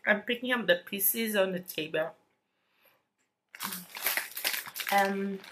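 talking between small bites; chewing while talking. Real, not acted. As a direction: mouth partly full of cake. Seen 2: Chewing at start, holds cake, puts crumbs to mouth later.

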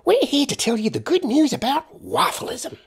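very high, annoyingly upbeat American woman; cheerful American accent. Acted. Woman pitching her creed on the doorstep, cheerful American accent. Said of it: "cheerfully in an annoyingly American accent".

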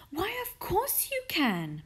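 high-pitched, delighted welcome; eager cry. A narrator's reading. Missus Silver inviting Mister Hoppy down, crying eagerly. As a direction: agree eagerly, pitched right up. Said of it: "Missus Silver cried".

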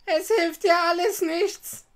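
high, loud scolding witch voice; old witch. A narrator's reading. Witch dismissing Gretel's tears, harshly scolding. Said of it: "sagte die Alte".

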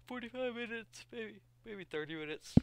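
talking through a yawn; high stretched yawn on the words. Real, not acted. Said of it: "sorry for the little yawn".

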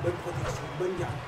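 low, hushed fight commentary; quiet. Real, not acted. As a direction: murmur the play-by-play.

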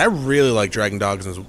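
ordinary talk, nothing marked

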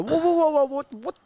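high, loud startled halt; re-enacted panicky objection; alarmed protest. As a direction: put the brakes on in sudden alarm. Acted alarmed refusal at car door.

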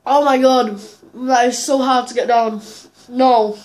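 struggling to swallow food; muffled behind hand; mouth full, chewing. Real, not acted. Seen 1: grimacing jaw works on food, then hand covers mouth while swallowing.

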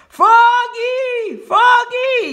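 very high, drawn-out shout; piercing long-distance call; yelling a name. A narrator's reading. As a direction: scream after someone running off. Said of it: "yelled Frogalina".

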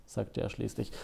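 usual speaking voice

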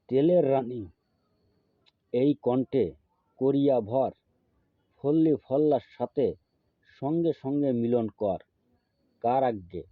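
emphatic chant broken into short pieces; slow mantra demo. Shown as a demonstration. Guru helping viewers memorise the mantra, slowly and emphatically. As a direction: recite it deliberately, pausing after each phrase. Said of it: "আস্তে আস্তে বলবো".

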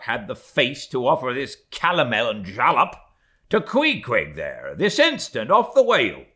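mate complaining to his superior about the steward; indignant mate. Acted. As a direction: make the case to the first mate, outraged. Stubb complains to Starbuck.

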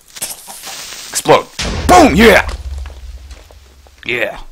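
loud high whoop of triumph; excited cheer. Real, not acted. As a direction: cheer the explosion. Creeper blowing up.

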